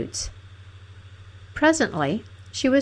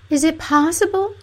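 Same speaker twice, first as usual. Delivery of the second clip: high-pitched, hurt princess voice; 10 semitones higher, 3 dB louder than usual. A narrator's reading. Princess to her uncaring companion, hurt. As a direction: disbelief in a much higher voice.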